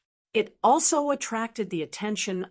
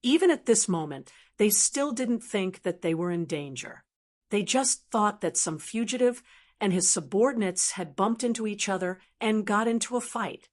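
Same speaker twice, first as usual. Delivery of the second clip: usual speaking voice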